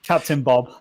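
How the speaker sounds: usual speaking voice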